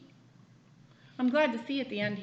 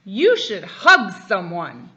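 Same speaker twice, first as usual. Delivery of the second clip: slow, loud snake voice; drawn-out big snake; 3 semitones lower, 14 dB louder, slower than usual. A narrator's reading. Father reading to child, slow loud snake voice. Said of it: "That's what that big snake said".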